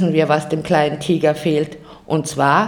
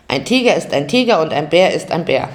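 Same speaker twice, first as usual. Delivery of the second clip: ordinary delivery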